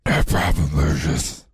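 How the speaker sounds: low growling Batman voice. Retold or imitated. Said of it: "He's like, he's like".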